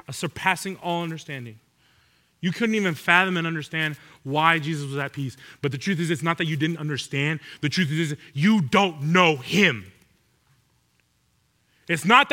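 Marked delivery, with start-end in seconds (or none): none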